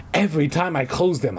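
normal talking voice

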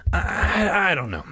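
halting, frustrated stammer. A narrator's reading. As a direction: stumble over the start, at a loss. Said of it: "Margaret answered in frustration".

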